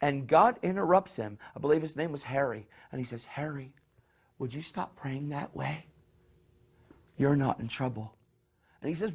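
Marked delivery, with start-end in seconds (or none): 3.2-8.2 hushed and gentle, much softer; calm him like a loving father; gentle voice of God. Retold or imitated. God speaks softly to Harry, much softer, gentle.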